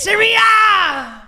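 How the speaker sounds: high, slow yelling call; loud yell. Real, not acted. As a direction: shout out for a revive. Yelling to be revived.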